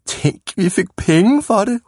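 high, gleeful exclamation; excited cry. A narrator's reading. Boy amazed they got paid for it, excited high cry. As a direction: cry out in disbelief, pitched high. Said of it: "råbte Mattis".

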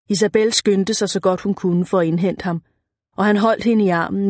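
ordinary delivery

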